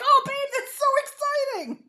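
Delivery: high, laughing mock-excited husband; high giggly impression of an excited husband; hype husband. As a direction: ham up an eager husband cheering. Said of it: "He'd be like".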